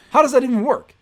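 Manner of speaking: how is that even possible, loud; incredulous. Real, not acted. Incredulous question to friend.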